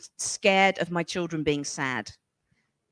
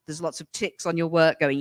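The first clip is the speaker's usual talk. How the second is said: ordinary talk, nothing marked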